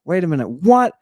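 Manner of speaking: normal talking voice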